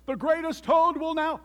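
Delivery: high, loud showman's announcement; grand stage announcer calling out; strange faraway announcer. A narrator's reading. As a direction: boom it out to a whole theater. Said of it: "Then the voice said".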